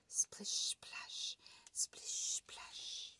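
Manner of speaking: soft whispered sound effect; whispered splish splash. A narrator's reading. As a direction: whisper the wet steps. Sound effect of wet feet.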